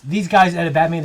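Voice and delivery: ordinary delivery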